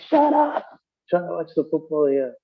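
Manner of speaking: mock irritated husband at the TV; grumpy husband. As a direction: shut her up so he can watch the game. Husband snapping at wife.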